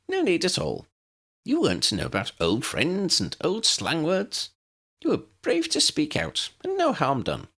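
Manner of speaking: put-on high, bright little old wizard, kindly reassuring; cheerful Professor Flitwick. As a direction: pitch it up as the tiny professor soothing Lydia. Narrator voices Flitwick.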